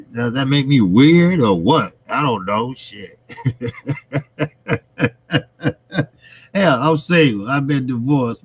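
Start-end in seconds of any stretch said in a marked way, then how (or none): 3.3-5.1 long chuckle, higher than his speech; real laugh. Real, not acted. Laughing at own confession.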